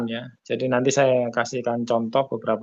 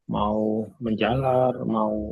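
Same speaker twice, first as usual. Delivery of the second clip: echoing voice; slower than usual. Real, not acted. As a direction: voice echoing through the room. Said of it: "suaranya menggema".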